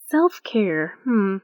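ordinary talk, nothing marked

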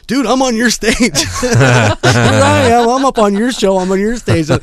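high, loud, gleeful mock gushing with a laugh; giddy excitement. Telling the sound guy he's on his stage, high excited gushing. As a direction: squeal it like a starstruck fan who got onstage.

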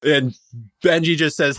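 ordinary talk

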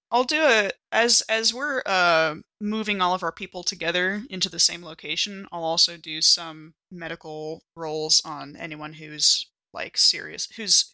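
usual speaking voice

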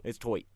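"toit" said with an oy vowel; jokey pronunciation. Shown as a demonstration. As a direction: repeat the joke "toit", oy for the vowel in "tight". Repeats the twisted version.

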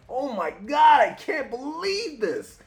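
high, stretched-out disbelief; shocked bystander. Retold or imitated. Fan voicing a stunned onlooker watching Spider-Man, high and amazed. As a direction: gasp it out like a stunned onlooker in the crowd. Awed bystander in comic panel.

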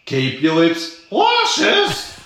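slow drawn-out sign-off ending in a high smooch; sultry sign-off. Acted. Guest signing off to listeners with his slow kissy catchphrase. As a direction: linger on the words, then blow a kiss. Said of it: "signature sign-off catchphrase".

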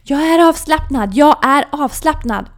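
high, loud forced self-assurance; shouting. Retold or imitated. Coach shouting at herself in hospital bed, loud high shout. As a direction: yell the words to calm yourself. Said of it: "skriker rakt ut är".

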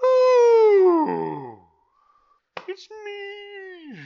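very high, loud falsetto; high squeal. Pretend. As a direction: squeal it like an excited child. Squeals at own photo.

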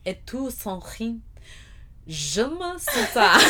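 mock French, slow and giggling; fake foreign language. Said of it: "I just made a bunch of random noises".

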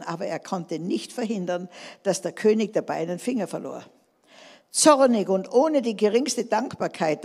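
ordinary talk

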